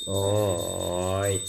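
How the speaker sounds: low, very drawn-out buffalo bellow; slow, low animal call. Shown as a demonstration. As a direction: imitate a buffalo with a long "oy". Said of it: "ಎಮ್ಮೆ ಹೆಂಗ್ ಕೂಗ್ತು".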